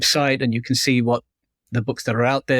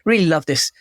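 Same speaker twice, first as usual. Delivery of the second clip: gushing, higher-pitched attempt at an American accent; bad American accent; 6 semitones higher than usual. Author quoting a Californian reader's letter, in a bad American accent. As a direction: put on a poor American accent. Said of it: "that's not a very American accent".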